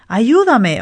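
loud high scream for help; a drowning boy yelling across the lake. A narrator's reading. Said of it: "a boy screamed".

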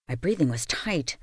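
usual speaking voice

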